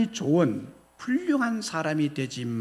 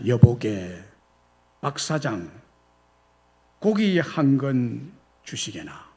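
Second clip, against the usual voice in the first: lower, measured gentlemanly request; dignified, courteous order; 6 semitones lower than usual. Retold or imitated. Second nobleman politely ordering meat from the butcher, dignified. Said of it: "점잖게 주문을 했습니다".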